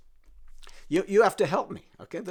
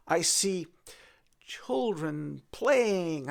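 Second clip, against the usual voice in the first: plain reading voice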